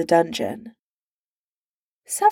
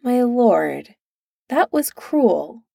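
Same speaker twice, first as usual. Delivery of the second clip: slow, higher, weary reproach; long-suffering; 5 semitones higher, slower than usual. A narrator's reading. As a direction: sigh it out like a patient servant. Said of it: "Merlin's long-suffering voice".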